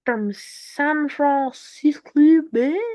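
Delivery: slow, stretched, strange-sounding reading; a goofy slowed-down announcer twist on the phrase; odd drawn-out voice. Acted. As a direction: drag the words out in a weird put-on voice. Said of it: "I don't know why I said it like that".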